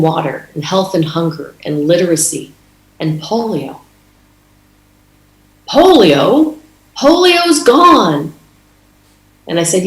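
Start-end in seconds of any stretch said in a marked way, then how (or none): 5.7-8.3 high, slow mock disbelief on Polio's gone; surprised disbelief. Officer hearing polio still exists, imitated high surprise. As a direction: stretch it out, a lightly played shocked officer.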